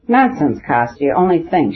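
plain reading voice

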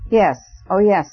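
eager to please, nervously flirtatious; quavering coy smile. A narrator's reading. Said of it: "with a quavering and almost coquettish smile".

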